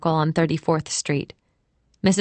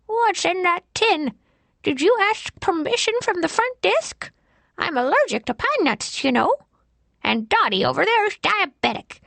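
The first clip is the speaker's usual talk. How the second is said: put-on high, fussy old lady voice; fussy old woman; 13 semitones higher than usual. A narrator's reading. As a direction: fret like a prim nursing-home lady. Fussy old resident character.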